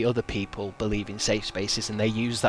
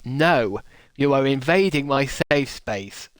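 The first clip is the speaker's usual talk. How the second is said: loud, higher, hammed-up protest; mock indignant refusal; 3 semitones higher, 6 dB louder than usual. Twitter user telling a falling atomic bomb no, mock indignation. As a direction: refuse the bomb with exaggerated outrage. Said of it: "they'd be like".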